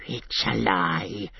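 slow, strange hissed denial. A narrator's reading. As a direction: hiss the denial with venom. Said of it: "hissed Gollum".

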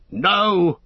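sharp high shout of command. A narrator's reading. Gandalf urging the company to flee, a high shout. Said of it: "shouted Gandalf".

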